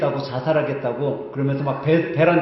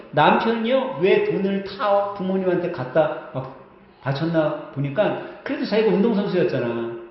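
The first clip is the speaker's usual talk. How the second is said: usual speaking voice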